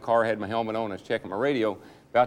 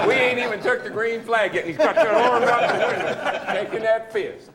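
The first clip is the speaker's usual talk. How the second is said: higher, giggling narration; laughing through it; 10 semitones higher than usual. Real, not acted. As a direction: tell it while cracking up, voice lifted high. Laughing while telling about Buddy.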